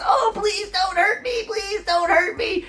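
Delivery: high, whiny goblin begging for mercy; scared little goblin pleading not to be hurt. Acted. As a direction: squeak out the plea like a cornered goblin. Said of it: "and he says".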